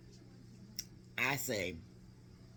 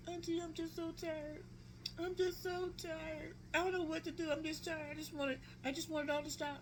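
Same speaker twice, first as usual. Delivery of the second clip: imitated weary despair; 5 dB quieter than usual. Niece on FaceTime telling auntie she's tired, imitated despair. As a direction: imitate a worn-out niece pleading weakly.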